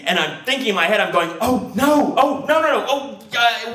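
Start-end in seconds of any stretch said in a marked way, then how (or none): 1.4-3.7 high, wailing dread; acted inner freak-out; mock panic. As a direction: moan in high-pitched alarm, faltering at the end.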